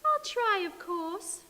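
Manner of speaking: a prim lady answering the phone. Acted.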